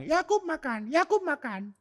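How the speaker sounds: high squeaky parrot voice saying "Yakub makan"; cockatoo mimicking speech. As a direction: squawk it like a talking bird. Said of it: "dia akan bilang".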